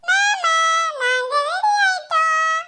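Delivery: put-on high, slow little kid voice; sing-song like a small child to uncle. Kids announce ready to uncle. (குழந்தை குரலில் மாமாவிடம்)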